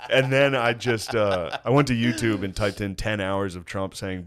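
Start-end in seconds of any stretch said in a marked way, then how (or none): none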